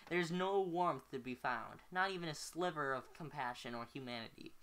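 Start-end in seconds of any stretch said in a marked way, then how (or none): none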